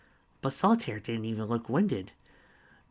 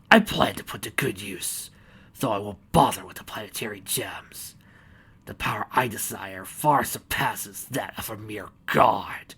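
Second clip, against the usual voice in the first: menacing whisper; 3 dB louder than usual. Acted. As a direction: hiss it like a villain. Villain's whispered menacing threat.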